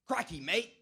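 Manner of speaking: high, loud Australian "Crikey"; Crocodile Hunter exclamation. Retold or imitated. Spotting the croc in his story, a high, loud Australian Crocodile Hunter cry. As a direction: yell it like an excited Aussie croc wrangler. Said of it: "my best Crocodile Hunter impression".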